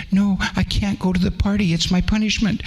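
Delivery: put-on a scared small boy refusing. A narrator's reading. Punished orphan boy timidly refusing the kind lady.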